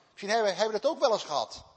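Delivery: ordinary talk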